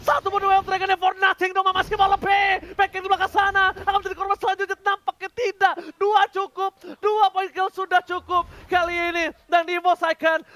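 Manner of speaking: loud, high-energy esports casting; excited play-by-play. Acted. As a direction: call the teamfight at full volume. Live hype play-by-play.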